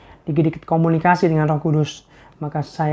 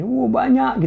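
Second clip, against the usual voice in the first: mock surprise; 8 semitones higher, much slower than usual. Mock reaction to own long reply.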